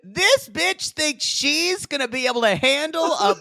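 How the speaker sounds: mock her cattily. Mocking the cast member.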